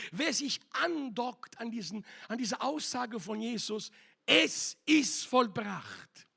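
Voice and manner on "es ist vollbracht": louder and slow, each word weighed; proclaiming cry. Acted. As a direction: ring it out like the cry from the cross. Preacher proclaims Jesus's cry.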